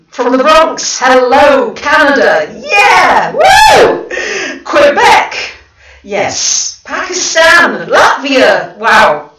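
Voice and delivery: high, loud whoops of delight; excited shout. Real, not acted. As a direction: cheer each country as it pops up. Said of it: "Gosh, I'm so excited".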